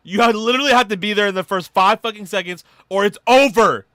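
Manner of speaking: loud, high, insistent delivery; emphatic warning. Real, not acted. Warning fans about the preorder rush, loud and emphatic. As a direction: belt it out, peaking on OVER.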